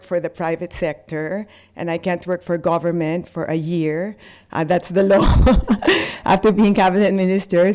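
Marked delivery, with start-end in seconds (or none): 5.2-7.7 laughing. Real, not acted. Laughs at the one-year ban.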